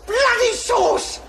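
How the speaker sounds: appalled outburst at the name; outraged shock. Acted. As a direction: blurt it in scandalised disbelief. Shocked at a neighbour's baby.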